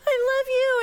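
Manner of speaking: high, crying words of relief; mother holding her found child, crying; tearful relief. Retold or imitated. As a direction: sob it out while hugging her. Mom holding found daughter.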